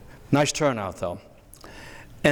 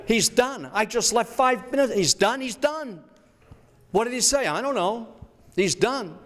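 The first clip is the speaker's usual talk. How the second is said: much higher, insistent and fed up; exasperated bystander; 10 semitones higher than usual. Pretend. Bystander tells the reporter it's over, much higher and insistent.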